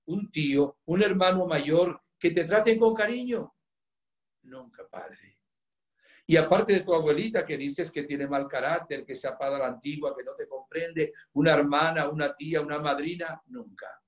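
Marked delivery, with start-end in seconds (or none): 4.4-5.4 very soft, low answer; hushed sad reply. Retold or imitated. Girl admits to the priest nobody treated her kindly, hushed. 13.5-14.0 very soft, low single word; hushed sad reply. Retold or imitated. Girl answers the priest about female relatives, hushed.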